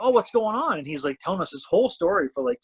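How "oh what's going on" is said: higher, playful concern; acting all curious; mock surprise.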